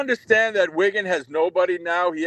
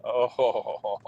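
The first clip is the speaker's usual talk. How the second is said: laugh; 7 semitones lower, 8 dB quieter than usual. Real, not acted. Caller laughs at boast.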